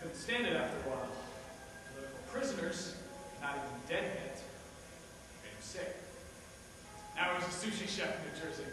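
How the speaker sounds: low, softening storytelling; subdued reminiscence. Acted. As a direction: let it drop toward a quiet finish. Subdued anecdote closing the monologue.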